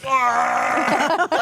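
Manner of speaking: high, loud imitated dog howl; frantic dog scream. Imitates second dog losing its mind.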